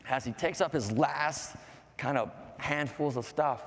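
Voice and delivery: usual speaking voice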